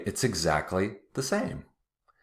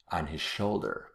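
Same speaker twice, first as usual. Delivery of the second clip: usual speaking voice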